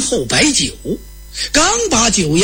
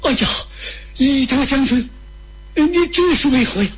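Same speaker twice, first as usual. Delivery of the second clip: high, startled appeal; taken aback, voice pitched up; frightened; 8 semitones higher than usual. Acted. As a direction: plead in acted alarm. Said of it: "当时把吕布吓坏了".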